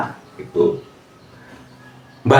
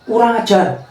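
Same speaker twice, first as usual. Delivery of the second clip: mildly put-on higher insulting voice; mock scolding; 9 semitones higher than usual. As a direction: scold someone in play, pitched up. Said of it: "ngomongnya negatif".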